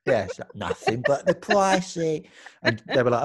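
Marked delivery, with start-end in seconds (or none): 0.5-2.2 slow, slurred imitation of her; a mild mock drunk drawl; drunk-sounding drawl. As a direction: lightly slur it like she's tipsy. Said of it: "It's kinda like a drunk".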